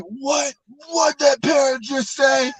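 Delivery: high, shocked shout of disbelief; outraged disbelief. Acted. Man in the joke shouts at the parrot's insult, in outraged disbelief. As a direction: yell like you can't believe your ears.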